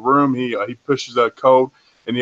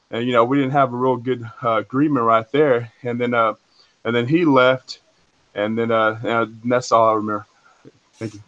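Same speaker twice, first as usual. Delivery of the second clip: ordinary delivery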